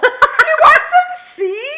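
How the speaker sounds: very high, loud, drawn-out mock squeal; imitating someone bumped up. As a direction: stretch the offer out in a wired shriek, laughing. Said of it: "she's, like, all bumped".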